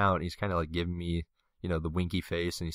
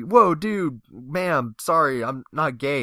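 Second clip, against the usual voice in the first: high, louder whoa then hurried sorry; flustered apology; 6 semitones higher, 5 dB louder, slower than usual. Retold or imitated. Pushing her away, a high flustered apology. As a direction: back off, tripping over words.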